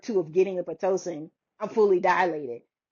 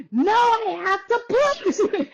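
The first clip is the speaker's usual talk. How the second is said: high, drawn-out wail, re-enacted; stretched high-pitched mock plea; urgent cry; 11 semitones higher, much slower than usual. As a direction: act out desperate labor urgency. Retold urgent cry in labor.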